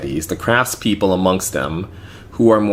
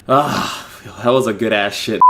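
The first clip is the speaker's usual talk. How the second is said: acted-out sigh of satisfaction; mock relief after the toilet; relieved groan; 2 semitones higher than usual. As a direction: re-enact an unguarded, satisfied exclamation. Acts out his overheard bathroom exit.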